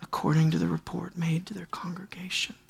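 hushed whispered repeat of the verse. Acted. Preacher echoing scripture to the congregation in a whisper. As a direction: drop to a breathy whisper to let it sink in.

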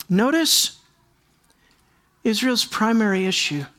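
usual speaking voice

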